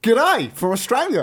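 loud, high, drawn-out Australian greeting; hammed-up Australian accent. Host reading a listener's message, hammed-up Australian accent. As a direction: put on a terrible, over-the-top Australian accent. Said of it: "reading it in an accent".

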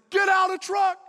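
high, loud shouted order; mom's angry shout. Retold or imitated. Mom throwing child out of truck, angry shout. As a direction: bellow the order at full pitch.

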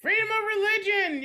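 high, loud proclamation starting at a peak; exuberant proclamation. Real, not acted. Quiz host confirming a contestant's answer, exuberant proclamation. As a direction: sing out the right answer with gusto.

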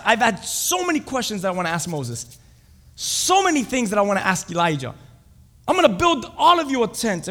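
loud, high, gushing excitement; carried away by your own brilliant idea; Peter. Acted. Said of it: "Peter was already thinking ahead".

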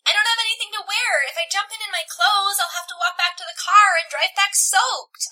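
high-pitched, loud whining; protesting, drawn-up high whine. A narrator's reading. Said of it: "I whine".

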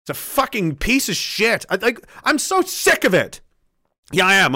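ordinary talk, nothing marked

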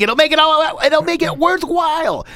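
climbing to a very high, comic pitch; hammed-up plug. Host jokingly urging listeners to like the page, hammed-up high plug.

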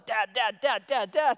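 rapid, insistent repeated calls; imitated nagging child. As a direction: play a kid interrupting Dad's conversation. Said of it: "I'll be talking with Marla".

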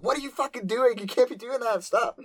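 put-on shrill high-pitched anime girl; high squeaky girl voice. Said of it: "She's like".

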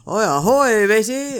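loud high pirate hail; hammy ahoy like a pirate. Pretend. Pirate costume, mock pirate greeting.

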